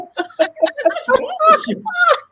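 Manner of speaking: high cackling laugh; loud high laugh. Real, not acted. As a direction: burst out laughing. Big laugh after story.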